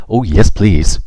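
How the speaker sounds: bright burst of eager hope. Acted. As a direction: beg with delight. Eager child begging.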